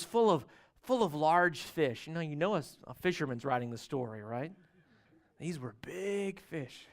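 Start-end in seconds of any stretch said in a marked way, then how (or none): 5.4-6.9 hushed awe. Acted. Fisherman's pride, hushed.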